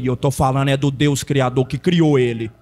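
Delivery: tom bem grave, firme; resposta grave e contida. Retold or imitated. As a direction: responda sem gritar, lá no grave. Resposta encenada, primeira vez, contida. (resposta firme em voz grave)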